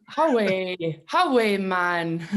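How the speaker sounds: put-on Newcastle accent; Geordie accent. Shown as a demonstration. Singer asked to demonstrate her Newcastle voice, playful Geordie accent. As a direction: call it out like a Geordie. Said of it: "really good rendition of a Geordie accent".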